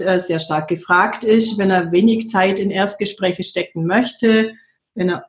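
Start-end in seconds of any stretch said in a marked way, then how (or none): 4.9-5.2 cold in the nose; speaking with a stuffy nose. Real, not acted. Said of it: "ich bin ein bisschen erkältet".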